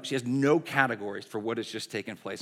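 normal talking voice

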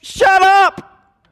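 high, loud command at the storm; Jesus shouting down the storm. Acted. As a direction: bark it at the raging wind. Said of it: "Jesus opens his mouth and says".

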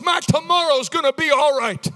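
high, loud preacher's proclamation; forceful declaration. Shown as a demonstration. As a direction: declare it with force for the crowd to repeat. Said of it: "Say it with force".

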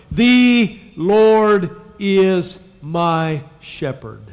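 each word drawn out very slowly; slow recitation. Shown as a demonstration. Pastor leading the congregation, slow and deliberate. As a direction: lay it down slowly for them to repeat. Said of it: "Say that with me".

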